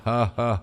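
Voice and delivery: taunting villain's ha ha; menacing laugh. Acted. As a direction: laugh to terrify the killer. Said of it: "آخری کہہ کہہ لگا کے".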